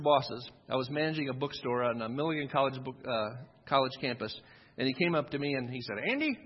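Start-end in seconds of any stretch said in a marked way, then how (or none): 6.1-6.4 a hint of the old boss, high calling "Andy"; boss's voice. Manager quoting his boss, lightly mimicked. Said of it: "that's how he talked".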